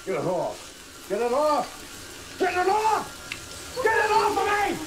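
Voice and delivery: panicked screaming. Acted. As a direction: scream harder each time. Attacked, panicking.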